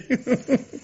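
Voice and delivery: quick high laugh after owning up; high short laugh. Acted. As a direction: let a giggle slip out. Husband laughs after admitting. (petit rire aigu)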